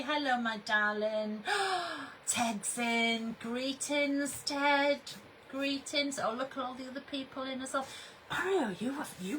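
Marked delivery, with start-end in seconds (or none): none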